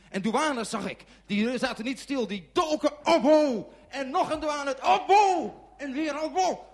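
rising to high, loud cries; frantic telling. A narrator's reading. Storyteller watching officers pile on girlfriend, frantic. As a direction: escalate with each blow, voice climbing.

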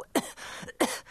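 deliberate little cough; attention-seeking cough. Acted. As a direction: cough to get noticed. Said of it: "probeerde wel een beetje de aandacht te trekken". (nadrukkelijk kuchje om aandacht te trekken)

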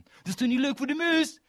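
very high, loud outraged yell; furious cry for the sparrow; shouted. Acted. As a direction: schreeuw verontwaardigd. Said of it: "schreeuwde Anton".

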